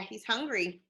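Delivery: ordinary delivery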